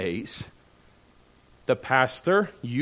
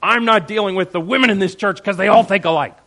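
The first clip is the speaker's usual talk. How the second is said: louder, higher, indignant parishioner quote; hammed-up angry complaint; indignant mock outburst; 5 semitones higher, 8 dB louder, faster than usual. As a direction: act out an offended member storming off. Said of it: "And then finally I go".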